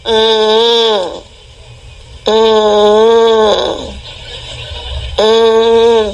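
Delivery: low droning mock cry; half-hearted child's whine. Preacher imitating a child crying in the background, droning whine. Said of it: "ada anak nangis gini nih".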